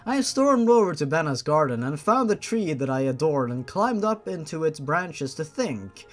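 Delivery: ordinary delivery, nothing marked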